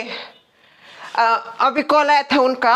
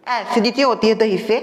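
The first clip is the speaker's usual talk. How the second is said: ordinary talk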